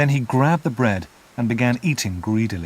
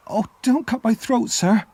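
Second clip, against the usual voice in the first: put-on small boy's voice, much higher, pleading; frightened boy crying out; 12 semitones higher than usual. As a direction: beg for his life like a scared child. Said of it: "I cried".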